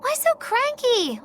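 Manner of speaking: high, drawn-out mock complaint; teasing protest. Teasing the companion who scolded her for prying, mock complaint. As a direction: protest with exaggerated slow, high pitch.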